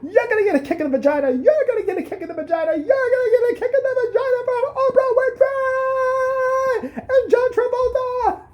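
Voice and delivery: shrieking high shout; Oprah impression. Comic Oprah shrieking threats at the interviewer. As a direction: yell it like a furious talk-show host. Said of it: "He's like".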